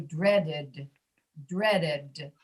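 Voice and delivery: DREADED in two syllables, final D voiced; voiced ED ending. Shown as a demonstration. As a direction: say "dreaded" slowly with a released voiced d. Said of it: "also be voiced".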